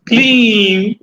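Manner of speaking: "clean" with a held, sustained ee; long penetrating E. Shown as a demonstration. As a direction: draw out the vowel of "clean" like a mantra. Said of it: "When you say pronounce clean".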